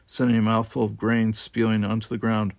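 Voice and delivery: ordinary delivery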